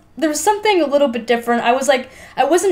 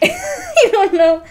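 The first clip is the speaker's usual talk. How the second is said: laughing; 12 semitones higher, much slower than usual. Real, not acted. Laughing while shrugging off actors.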